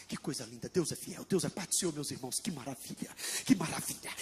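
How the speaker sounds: hushed awe. Acted. Barnabé marvels at the church, hushed. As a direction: murmur your praise as if overwhelmed. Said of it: "Barnabé chegou e disse".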